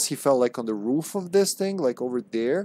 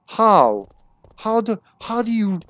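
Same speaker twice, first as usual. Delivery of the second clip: incredulous; 8 semitones higher, 5 dB louder than usual. Real, not acted. Exasperated at Jill's light mistake.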